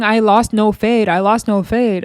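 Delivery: ordinary talk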